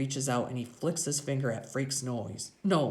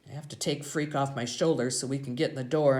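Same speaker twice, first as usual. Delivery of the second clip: ordinary delivery, nothing marked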